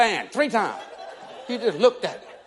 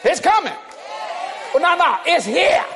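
building shout. Real, not acted. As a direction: lift it up to a shout like a preacher in full swing.

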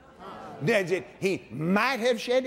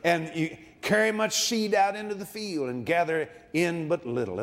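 normal talking voice

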